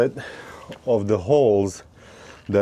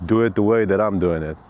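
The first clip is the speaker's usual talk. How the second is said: ordinary talk, nothing marked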